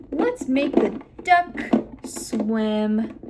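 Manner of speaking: very slow, "duck" and "swim" punched; emphasis on important words. Shown as a demonstration. Clinician playing with the child, emphasizing important words. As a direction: draw out and stress "duck" and "swim". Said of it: "emphasizing those important words".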